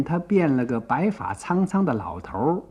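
ordinary delivery

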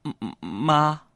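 slow, halting confession to mom; acted careful, stretched-out delivery; held-back hesitation. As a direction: drag the words out as if reluctant to say them. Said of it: "但是我就忍了忍".

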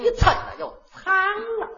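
high, slow, whining woman's voice; whiny Tianjin woman. Acted. A Tianjin auntie complaining about her clothes, high and whiny. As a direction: wail it high like a fussing auntie.

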